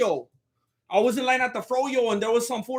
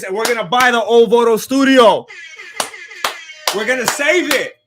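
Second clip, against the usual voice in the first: hype shout; 2 semitones higher, 7 dB louder than usual. Real, not acted. Hyping fundraiser to chat.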